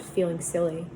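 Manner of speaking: plain reading voice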